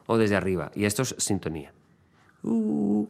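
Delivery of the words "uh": high, drawn-out "uh" swell; imitated sea wave. Shown as a demonstration. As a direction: voice a rising wave, high and held. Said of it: "como la ola del mar". (ulular agudo como una ola)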